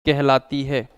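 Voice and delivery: slow, spaced dictation with long pauses; teacher dictating notes, one phrase at a time; dictation pace. Shown as a demonstration. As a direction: read it out phrase by phrase for students to write down. Dictating the definition.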